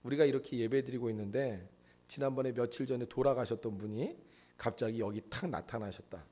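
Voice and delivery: ordinary talk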